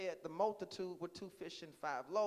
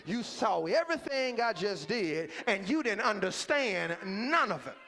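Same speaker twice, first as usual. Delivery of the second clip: rousing church preacher in full cry; full-force preaching; 3 semitones higher, 12 dB louder than usual. Real, not acted.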